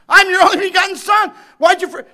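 high, loud anguished shout to the Father; anguished cry of Christ. Acted.

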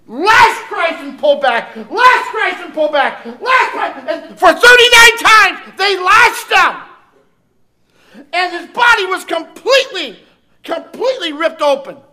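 high, loud, hammering preacher's cry; preaching at full force. Acted. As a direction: raise the voice like a revival preacher pounding the pulpit. Preacher describes the scourging.

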